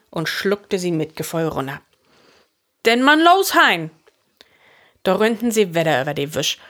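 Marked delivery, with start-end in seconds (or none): 2.9-3.9 high, loud spur to get going; urgent call. A narrator's reading. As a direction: call him into action. Fritz drives Hein to run. (luud andrieven)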